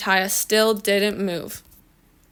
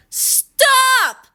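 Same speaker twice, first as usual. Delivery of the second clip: very high, drawn-out shriek; all-out yell; 4 dB louder than usual. A narrator's reading. Girl picked up by the ear, all-out yell. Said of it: "yelled as loudly as she could".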